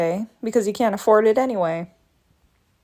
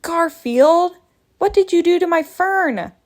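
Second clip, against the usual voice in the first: higher, louder outraged yell at the cat; angry call; 7 semitones higher, 4 dB louder than usual. Acted. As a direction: shout at him over the ruined fern. Angry at cat over fern.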